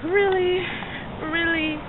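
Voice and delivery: incredulous. Retold or imitated. Retelling disbelief at being sent back.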